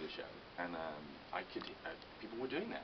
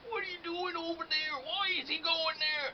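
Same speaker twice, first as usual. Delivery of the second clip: high, slow mocking Canadian accent; Canadian accent impression; 8 dB louder, slower than usual. Imitating drunk Canadian neighbours, slow high Canadian accent. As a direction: drawl it out in an exaggerated Canadian accent. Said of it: "that was an accent I recognized".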